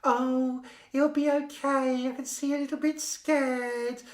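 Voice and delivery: high, loud, talking-down nurse voice; patronising nurse. Retold or imitated. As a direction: coo at her like a scared child. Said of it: "the nurse was like".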